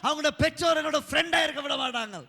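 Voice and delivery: loud, high-pitched preaching; full-force preaching. Real, not acted. Preacher tells congregation how parents shunned him, full-force preaching. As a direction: preach it out at a shout.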